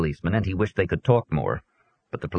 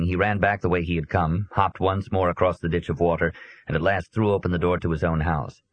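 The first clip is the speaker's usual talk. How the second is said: usual speaking voice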